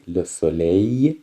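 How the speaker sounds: soleil said slowly with the final l left silent; slow model of soleil for learners; French pronunciation. Shown as a demonstration. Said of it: "این ال آخرش خونده نمیشه".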